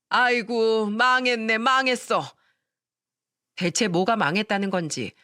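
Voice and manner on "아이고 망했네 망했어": mock wailing. A narrator's reading. Mother-in-law bewailing the ruined charm to her daughter-in-law, in a mild wail. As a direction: wail over a ruined charm, lightly acted. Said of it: "땅을 치며 오열했어요".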